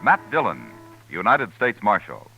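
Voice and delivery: ordinary delivery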